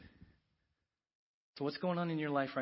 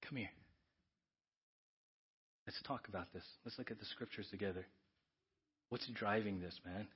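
softer, quiet come-here to a friend; gentle invitation; 3 semitones lower, 9 dB quieter than usual. Acted. Get-back coach gently calling a brother over, soft. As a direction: draw him in kindly and quietly. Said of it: "who says".

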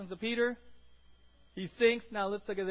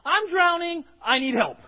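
urgent plea; 7 semitones higher, 12 dB louder than usual. Acted. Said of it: "like Peter".

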